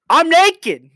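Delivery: sudden loud, high outburst; joking shout. Pretend. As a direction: blurt it out loud for a laugh. Sudden joking announcement, then retracted.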